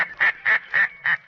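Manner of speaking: a loud, taunting laugh at the trapped villain; mocking laugh. The Shadow mocks Richter with a loud, gloating laugh. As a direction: laugh him to scorn.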